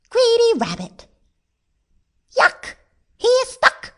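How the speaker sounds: squeaky onlooker. A narrator's reading. Onlooker seeing the rabbit stuck, high squeaky scorn. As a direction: squeal it in mild disgust.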